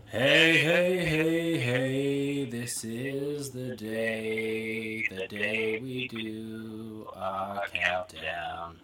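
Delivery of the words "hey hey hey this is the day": usual speaking voice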